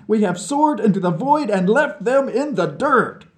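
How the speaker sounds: tipsy scientist boasting to a new pal; drunken boast. A narrator's reading. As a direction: crow about beating the Americans. Vlad's drunken rant.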